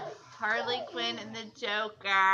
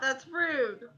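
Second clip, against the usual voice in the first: ordinary delivery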